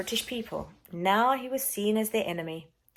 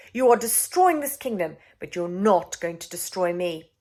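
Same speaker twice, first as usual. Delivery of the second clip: defiant accusation; 3 semitones higher, 6 dB louder than usual. Acted. Queen confronts villain.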